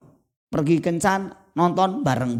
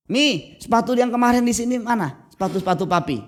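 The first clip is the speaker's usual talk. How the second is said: raised, higher call to the wife in the next room; husband calling out; 7 semitones higher than usual. Retold or imitated. Husband calls out to his wife about missing shoes, raised. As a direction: shout it across the house, looking for the shoes.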